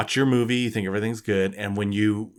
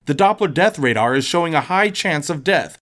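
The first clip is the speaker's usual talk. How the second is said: hammed-up TV weatherman, higher and bright; mock weather report; 6 semitones higher than usual. Host signing off a spooky forecast, cheesy weatherman read.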